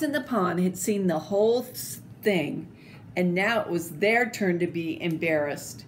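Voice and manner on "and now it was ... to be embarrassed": plain reading voice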